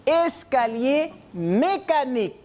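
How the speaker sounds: "Escalier mécanique" said slowly, syllable by syllable; teacher modelling "Escalier mécanique" slowly; slow pronunciation model. Shown as a demonstration. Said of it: "Comment ça se prononce?".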